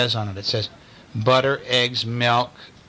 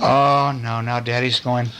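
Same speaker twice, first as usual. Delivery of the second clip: usual speaking voice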